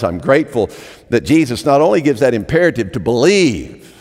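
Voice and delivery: ordinary delivery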